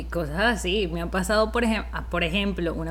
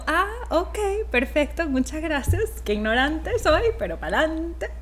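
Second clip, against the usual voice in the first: cheery self-mockery; 7 semitones higher than usual. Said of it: "Y yo".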